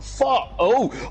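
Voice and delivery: sudden high-pitched yelp of shock; retold panicked wake-up cry; startled curse. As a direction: jolt awake and blurt it out, high. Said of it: "I was like".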